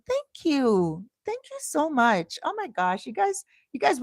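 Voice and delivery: high-pitched, gushing gratitude; real overwhelmed delight, pitched high; touched, excited thanks. Real, not acted. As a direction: gush with thanks to adoring fans. Overwhelmed by welcome from viewers.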